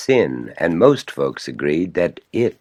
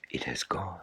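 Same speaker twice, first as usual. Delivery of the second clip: whisper; 17 dB quieter than usual. A narrator's reading. Whispered repeat of line.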